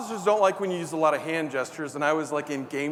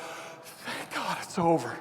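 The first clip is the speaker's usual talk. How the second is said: relieved; 4 dB quieter, slower than usual. Retold or imitated. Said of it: "one of those relief moments".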